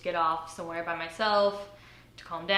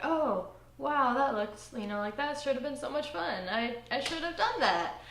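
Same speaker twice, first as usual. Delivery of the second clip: higher-pitched imagined regret; wistful looking back; 7 semitones higher than usual. Retold or imitated. Looking back on fun she skipped, wistful. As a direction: voice a thought of having missed out. Voices her regretful later self.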